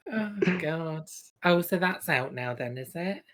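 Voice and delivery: ordinary talk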